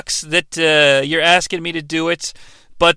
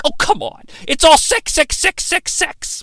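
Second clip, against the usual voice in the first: rising high Dawson rant, ending in a whisper; hammed-up promo-style whine, climbing high; Paul Heyman-ish; 8 semitones higher than usual. Dawson pleading with his father, rising to a whispered hype. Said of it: "why I sounded like Paul Heyman there".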